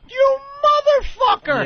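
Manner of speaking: very high, drawn-out furious shriek; imitated enraged scream. Host imitating guest whose CD got broken, enraged shriek. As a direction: shriek it slowly in hammed-up rage. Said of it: "and he goes".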